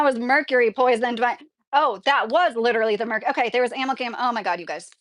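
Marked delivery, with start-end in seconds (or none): none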